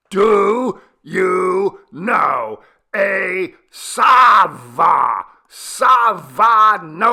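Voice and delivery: very loud, slow, high strained yell. A narrator's reading. Villager yelling again to the deaf grandmother. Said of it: "Chebo yelled".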